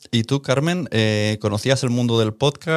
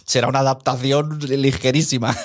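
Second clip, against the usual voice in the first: laughing; 3 semitones higher than usual. Real, not acted. Ironic remark about the adaptation.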